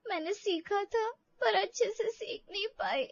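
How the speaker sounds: high, sobbing words; crying. Acted. Daughter-in-law crying to the mother-in-law about failing, sobbing. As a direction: cry out the excuse. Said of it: "प्रिया रोने लगती है".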